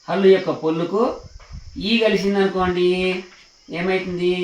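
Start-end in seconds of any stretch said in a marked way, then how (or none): none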